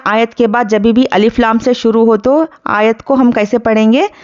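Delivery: ordinary talk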